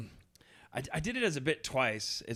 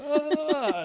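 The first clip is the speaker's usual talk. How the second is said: high startled ah; 11 semitones higher, 4 dB louder than usual. Retold or imitated. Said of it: "you're just like".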